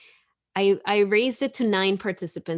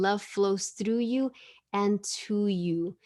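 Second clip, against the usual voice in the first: slow, measured affirmation; slow and gentle; slow reverent card reading; slower than usual. Shown as a demonstration. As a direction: read it out like a blessing. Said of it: "I'm gonna read that again".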